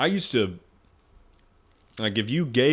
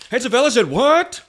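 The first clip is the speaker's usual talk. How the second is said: much higher and louder squawk, hammed-up; mock outraged commenter; 7 dB louder than usual. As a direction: play an indignant internet commenter. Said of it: "People are gonna be like".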